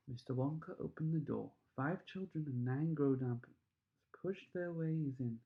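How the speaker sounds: ordinary delivery, nothing marked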